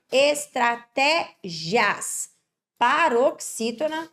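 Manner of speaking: Estratégias drawn out very slowly, leaning on the té; slow classroom emphasis on the té of Estratégias; slow stress demo. Shown as a demonstration. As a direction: stretch Estratégias so the class hears the tonic syllable. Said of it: "olha aqui, paroxítona".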